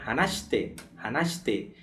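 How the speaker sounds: "hanashite" with the "shi" nearly silent, like hanaste; devoiced shi. Shown as a demonstration. Teacher models hanashite for students, barely saying the "shi". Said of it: "Fica só o shi, quase".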